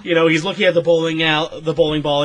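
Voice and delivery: ordinary talk, nothing marked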